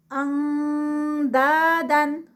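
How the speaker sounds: اندادن slow with a light nasal نون before د; light ikhfa recitation. Shown as a demonstration. Teacher recites slowly with light ikhfa. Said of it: "تو اخفا light ہوگا".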